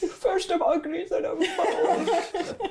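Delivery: high, slow put-on creature voice; offended spider. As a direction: whine it high as an insulted monster, half laughing. Voicing the insulted spider.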